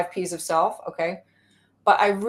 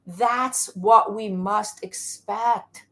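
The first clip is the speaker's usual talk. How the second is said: ordinary talk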